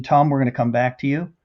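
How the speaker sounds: ordinary delivery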